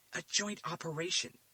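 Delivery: can't believe it; shocked. A narrator's reading. Agent Fisher returning to find a joint operation, shocked. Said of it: "as if the world had turned upside down".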